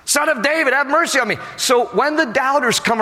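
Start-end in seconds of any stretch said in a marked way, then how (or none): 0.0-1.4 high, insistent plea; quick, high-pitched call for mercy; defiant louder cry. Acted. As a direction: cry out high over the doubters. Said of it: "I'm gonna cry out even louder".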